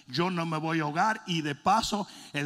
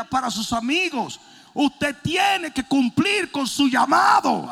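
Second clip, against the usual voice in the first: preaching shout; 9 semitones higher, 5 dB louder than usual. Acted. Preacher driving home point.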